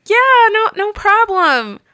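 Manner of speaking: high, loud, strained yes-no-problem; flustered politeness. Retold or imitated. Manager answering the weeping guest, high flustered squeak.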